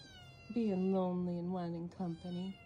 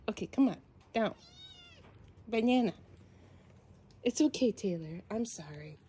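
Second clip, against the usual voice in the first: usual speaking voice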